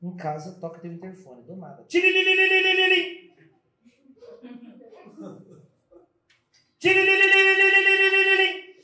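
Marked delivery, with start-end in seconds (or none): 1.8-8.8 sung electronic trill; intercom ring. Retold or imitated. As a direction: mimic an intercom buzzing.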